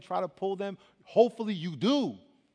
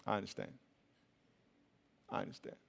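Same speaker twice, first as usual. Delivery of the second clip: soft acceptance; 10 semitones lower, 15 dB quieter than usual. Retold or imitated. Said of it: "You know what he said?".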